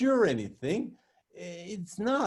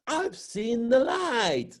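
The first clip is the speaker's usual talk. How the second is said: higher, slow, mock rapture; mock ecstatic believer; 6 semitones higher, much slower than usual. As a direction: ham up a churchgoer's ecstasy, slowly. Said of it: "You want ecstasies".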